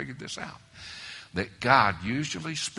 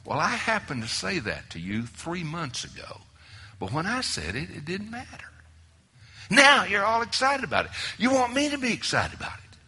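retold retort building louder to a breathy duh; sarcastic wife; 8 semitones higher, 7 dB louder than usual. Preacher voicing his wife's sarcastic comeback, building. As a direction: let her sarcasm build to a scoff.

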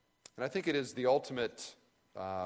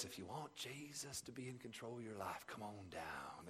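put-on southern preacher accent; 14 dB quieter than usual. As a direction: coax them down in a gentle southern lilt. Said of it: "in his sort of lilting southern voice".

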